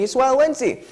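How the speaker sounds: loud, high show-host hype; hyped announcement. Real, not acted. Morning show host announcing the segment, hyped up. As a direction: sell the segment title to the audience.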